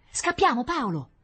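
high, loud urgent plea falling away; alarmed urging. A narrator's reading. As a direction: urge your brother to run. Rita urges Paolo to flee. (esortazione allarmata)

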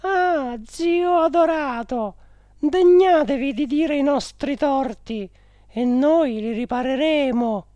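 high, loud woman's entreaty; pleading directress. A narrator's reading. Directress begging her adored uncle to name their wrongs, high pleading. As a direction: implore in a high, heightened voice. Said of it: "che domandò".

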